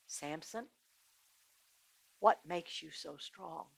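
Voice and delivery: hushed, low, with a pause after the name; soft coaxing. Acted. Delilah to Samson before he sleeps, softly coaxing.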